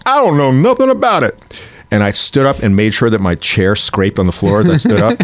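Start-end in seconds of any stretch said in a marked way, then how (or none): 0.1-1.4 much higher, put-on comic voice; hammed-up high comedian voice; mock punchline voice. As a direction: squeak out the line like a caricature.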